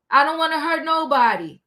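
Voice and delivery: usual speaking voice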